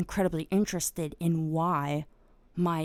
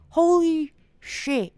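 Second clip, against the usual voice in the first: loud, high amazed outbursts, swearing in wonder; excited; 5 semitones higher, 6 dB louder than usual. Pretend. Teacher pretending to be amazed, getting excited and loud. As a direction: burst out in mock astonishment at moving an object. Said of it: "I'm getting excited. I'm being loud".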